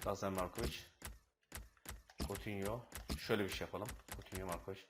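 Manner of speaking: very low, soft muttering through tactics; hushed self-talk while clicking menus; muttering to himself. Real, not acted. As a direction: mumble the commands under the breath.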